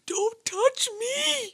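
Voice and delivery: very high, slow drawn-out cry; shrill cry. Pretend. Voices the heroine's refusal.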